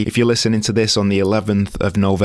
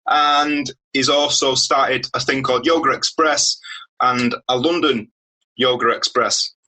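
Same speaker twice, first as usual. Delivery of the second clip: bright, raised announcer delivery introducing the guest; podcast host intro; 6 semitones higher than usual. Acted. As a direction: sell the show like a radio host welcoming listeners.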